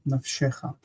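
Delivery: weight the middle syllable of "Nafshecha"; stress on SHE. Shown as a demonstration. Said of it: "shifts and it becomes nafshecha".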